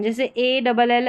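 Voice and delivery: ordinary talk, nothing marked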